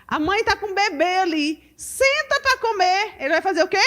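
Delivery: ordinary talk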